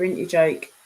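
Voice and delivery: usual speaking voice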